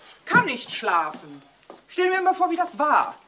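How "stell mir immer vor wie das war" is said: rising, louder and higher with agitation; agitated. Acted. Wife pressing her husband at night, higher and louder. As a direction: she can't let the image go.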